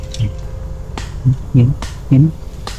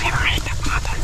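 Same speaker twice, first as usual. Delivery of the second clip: hushed whisper while hiding; 10 dB quieter than usual. Real, not acted. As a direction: whisper so they don't hear you. Hiding, watching armed strangers.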